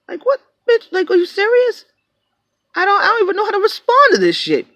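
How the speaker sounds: ranting at the buyer's question; incredulous high outburst. Real, not acted. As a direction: shoot up high in outrage. Ranting indignantly about buyer.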